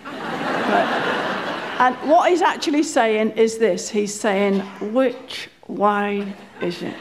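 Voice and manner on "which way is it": low, slow words through gritted teeth. Retold or imitated. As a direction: hiss "Which way is it?" through clenched teeth. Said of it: "through his teeth".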